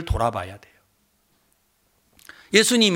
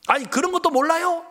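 snapping challenge; 6 semitones higher than usual. Retold or imitated. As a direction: imitate an incredulous, needling comeback. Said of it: "예수님에게 막 따지듯이 쏴붙였어요". (따지듯 높은 소리로 쏘아붙이기)

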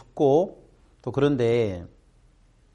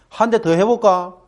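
cocky boast; 7 semitones higher, 5 dB louder than usual. Retold or imitated. Son showing off.

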